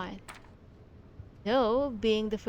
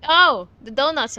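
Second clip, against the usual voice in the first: suddenly louder, delighted cry; amused exclamation; 2 semitones higher, 8 dB louder than usual. Real, not acted. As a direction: blurt it out, tickled by the donuts. Spots donuts in the game.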